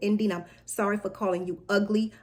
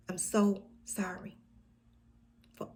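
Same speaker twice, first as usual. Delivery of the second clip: whispering; 3 dB quieter than usual. Acted. Pained confession, whispered slowly.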